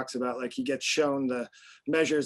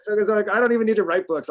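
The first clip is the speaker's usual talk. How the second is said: much higher and louder playful boast; excited joke; 8 semitones higher, 8 dB louder than usual. Pretend. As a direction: toss off the joke with a grin.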